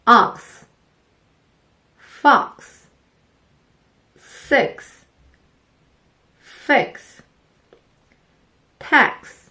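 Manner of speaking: Ox, fox, six, fix, tax, each said slowly and apart, ending in a crisp ks; English word drill. Shown as a demonstration. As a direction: model words ending in ks. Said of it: "X로 끝나는 단어 몇 개만 연습해 보겠습니다".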